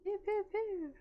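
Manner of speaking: squeaky laser gun sounds; high-pitched pew noises. Pretend. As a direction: zap it like a toy blaster. Mock shooting noise.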